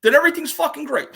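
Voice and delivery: loud, high mock exuberance; imitated emphatic cheer. Retelling a friend's pep talk, emphatic cheer.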